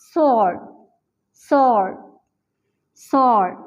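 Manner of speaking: sword said as "sord", no w sound; silent w in sword. Shown as a demonstration. Teacher modelling sword without the w. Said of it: "It's pronounced like that".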